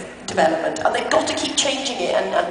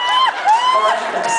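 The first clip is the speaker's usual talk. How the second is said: mock horror; 5 dB louder, much slower than usual. Reacting to co-star's antics.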